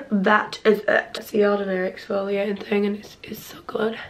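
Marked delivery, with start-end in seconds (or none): 1.2-4.0 soft hushed whisper. Real, not acted. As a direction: keep it to a whisper. Whispering late at night.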